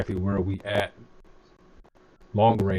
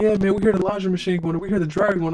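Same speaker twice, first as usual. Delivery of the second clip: imitating chat viewers; 11 semitones higher, 4 dB louder than usual. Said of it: "Y'all usually hear something like".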